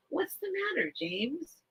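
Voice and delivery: high soft concern; pet talk. Real, not acted. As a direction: ask the bird gently. Asking the bird.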